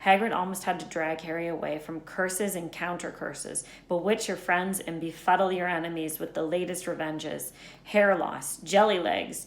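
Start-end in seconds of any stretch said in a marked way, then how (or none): none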